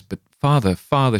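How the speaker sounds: mother begging father through tears, mildly pleading; pleading cry. A narrator's reading. Crying out to her husband in mild distress. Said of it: "she cried".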